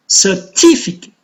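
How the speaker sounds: "Certificate" with TI stressed; cer-TI-fi-cate, peak on TI in "Certificate"; stress on second syllable. Shown as a demonstration. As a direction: punch the TI in "Certificate". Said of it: "it is also on the second".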